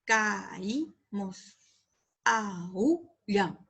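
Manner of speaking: "Caímos, aullan" drawn out as ca-í-mos, a-ú-llan; split hiatus. Shown as a demonstration. Teacher showing hiatus examples, vowels pulled apart.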